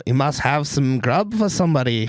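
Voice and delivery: a bad imitation of an Australian accent; badly faked Australian accent, hammed-up. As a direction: lightly fake Australian front-desk lady. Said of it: "there was an Australian lady".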